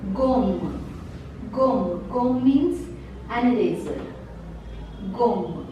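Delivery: gomme said gom, short o; French gomme. Shown as a demonstration. Teacher modelling gomme for students. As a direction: close gomme on a clean M. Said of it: "the last T is silent".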